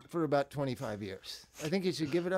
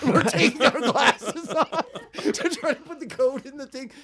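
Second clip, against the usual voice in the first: laughing; 10 semitones higher, 9 dB louder than usual. Real, not acted. Laughing while telling story.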